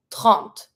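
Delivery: "Trente" with the T sounded, no final E. Shown as a demonstration. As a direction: land on the T of "Trente". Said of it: "I pronounce the T".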